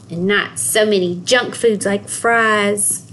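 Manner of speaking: ordinary delivery, nothing marked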